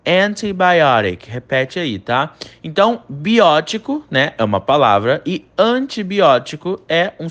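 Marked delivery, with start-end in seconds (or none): 0.1-1.3 slow model antibiotic; careful English pronunciation. Shown as a demonstration. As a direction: spell out antibiotic slowly in English. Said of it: "Preste atenção na pronúncia". (pronúncia lenta e clara de antibiotic em inglês)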